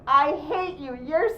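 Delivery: put-on loud, high-pitched child's outburst; angry four-year-old. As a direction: lash out like a furious little kid. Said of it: "maybe they're four and they go".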